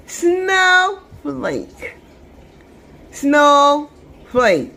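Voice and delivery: "Snowflake" as two slow, separate beats; split into syllables. Shown as a demonstration. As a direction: stretch "Snow", pause, then "flake".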